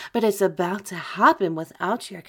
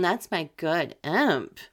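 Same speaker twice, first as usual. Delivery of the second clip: usual speaking voice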